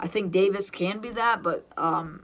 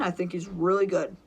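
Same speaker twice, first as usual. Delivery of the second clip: ordinary talk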